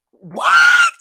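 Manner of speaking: shrieked high and loud; a sudden scandalised shriek; shocked. Real, not acted. Hearing about the cannoli cream, shrieking in shock.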